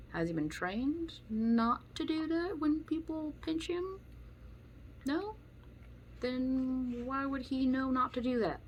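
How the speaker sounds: high, slow, sarcastic questioning; incredulous mock question. Real, not acted. To critics of the dog, high slow sarcastic disbelief. Mocking dog owners' logic.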